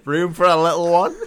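loud, high, laughing jeer; cheeky soldier heckle. Retold or imitated. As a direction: shout it through a laugh.

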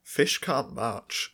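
very slow, each word weighed; slow deadpan. Real, not acted. Correcting a friend about fish, slow deadpan. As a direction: state it slowly as gospel.

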